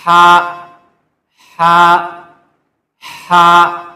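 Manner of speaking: ہا repeated loud from the middle of the throat; correct throat letter. Shown as a demonstration. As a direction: drill ہا firmly from the throat. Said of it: "اس کا تلفظ یہ ہے ہا". (گلے سے زور دار ہا بار بار)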